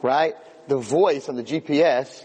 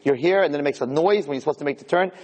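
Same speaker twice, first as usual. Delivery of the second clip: ordinary talk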